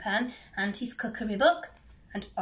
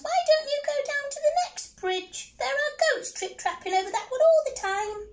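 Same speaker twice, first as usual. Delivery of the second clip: little mouse; 5 dB louder, faster than usual. Acted. Mouse answers the troll under the bridge, high squeak.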